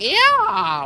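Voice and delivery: very high strained screech. Retold or imitated. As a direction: cry out in mock pain. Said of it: "He's, like, fucking screeching".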